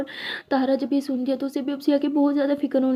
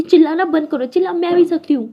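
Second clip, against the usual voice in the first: loud, sharp shout back; shouting back at a screaming sister; angry shout; 3 semitones higher, 7 dB louder than usual. A narrator's reading. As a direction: yell to stop her yelling.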